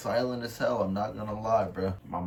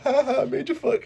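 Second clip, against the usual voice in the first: high, laughing streamer voice, pitched way up; Kai Cenat impression; 5 dB louder than usual. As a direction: cackle it out like a hyped streamer. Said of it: "the Kai Cenat clip where he was like".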